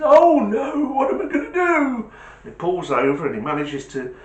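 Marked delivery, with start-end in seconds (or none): 0.0-2.1 put-on high, loud, fretful old man's cry of "oh no"; old man's dismay. Old cyclist panicking over his snapped chain, high and fretful. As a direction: play the old man stranded with a broken chain.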